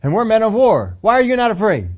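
loud, high mock soldier questioning; soldiers' loud challenge. Soldier challenges David, loud and high. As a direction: bark it out like a scornful soldier. Preacher voicing frightened soldiers.

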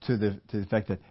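ordinary talk, nothing marked

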